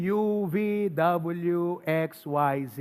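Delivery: slow, drawn-out letter names; alphabet recital. Shown as a demonstration. Pastor showing what kindergarten taught, slow alphabet recital.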